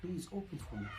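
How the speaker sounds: softly, gently coaxing a shy patient; calm, quiet invitation to open up; soft reassuring tone. Shown as a demonstration. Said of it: "your tone of voice".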